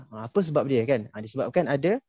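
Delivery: normal talking voice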